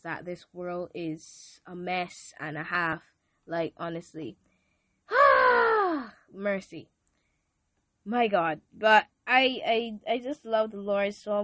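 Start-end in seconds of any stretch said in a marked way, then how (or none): 5.1-6.1 heavy sigh. Real, not acted. Moved by thoughts of the world.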